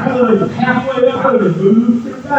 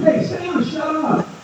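put-on child quoting; 6 semitones higher, 5 dB quieter than usual. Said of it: "her daughter goes".

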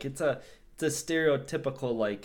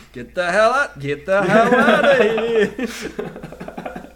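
repeated loud mock yell, rising higher; John McClane yelling; 8 semitones higher, 10 dB louder, much slower than usual. Imitating McClane meeting hostage after hostage, hammed-up yelling. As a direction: bellow it twice, dragging out the second, breaking into laughter. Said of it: "every single time Bruce Willis".

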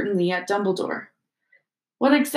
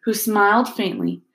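plain reading voice

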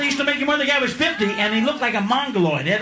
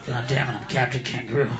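despairing Captain Kangaroo; 13 semitones lower, 4 dB quieter than usual. Retold or imitated.

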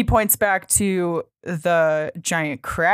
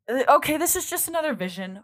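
higher, quicker brush-off; retold dismissiveness; 9 semitones higher, faster than usual. Liz brushing off the ghost's vision, dismissive. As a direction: wave it away in a raised, hurried voice.

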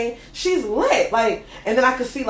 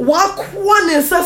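mock African accent, louder, higher and drawn out; 8 semitones higher, 6 dB louder than usual. Imitating his mom for a friend, exaggerated African accent. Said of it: "almost so they're African".

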